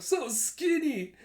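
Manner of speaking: high, slow, drawn-out amusement; mock laughing exclamation. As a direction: tease as the laughing bystander. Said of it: "The bystander just laughs".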